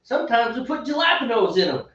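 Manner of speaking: mangle the Spanish food words in exaggerated Texan; mock Texan accent. Imagining what the recorded girl says next, mock Texan accent.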